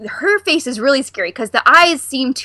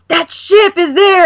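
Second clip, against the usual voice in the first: put-on amazed kid gasp; 8 semitones higher, 6 dB louder, slower than usual. Retelling the kids' real reactions.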